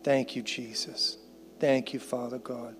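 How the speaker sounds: soft, hushed prayer; quiet thanks to God. Real, not acted. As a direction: pray softly and unhurriedly, almost under your breath at the end.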